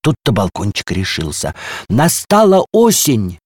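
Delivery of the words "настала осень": high, loud, slow proclamation; at full voice. A narrator's reading. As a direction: announce it out loud, drawing it out. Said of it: "сказал он во весь голос". (торжественно, во весь голос)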